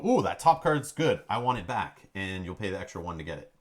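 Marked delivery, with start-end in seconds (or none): 0.0-2.1 excited greed. Retold or imitated. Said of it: "you'll look over and go".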